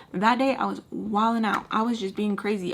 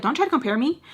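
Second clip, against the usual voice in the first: raised, higher-pitched telling-off; indignant retort; 6 semitones higher, 5 dB louder, faster than usual. Retold or imitated. Girlfriend rejecting a comparison to another woman, indignant retort. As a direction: warn him off, voice pushed up. Retold quarrel with boyfriend.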